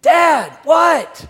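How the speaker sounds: put-on high, loud child's yell from a distance; kid calling back. Son answers his panicked dad from afar, yelling. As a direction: holler back like a bothered kid. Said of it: "I hear this faint little voice".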